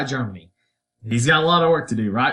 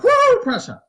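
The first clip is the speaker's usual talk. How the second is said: high, loud whoop of mock cheering; 7 dB louder than usual. Northern states cheering for Prussia in a high, loud whoop. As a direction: cheer like a fan for your team. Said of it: "are like".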